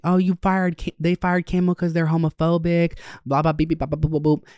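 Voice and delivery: high, chattering mimicry of the roommate; mocking high voice. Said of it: "she was like, talking like".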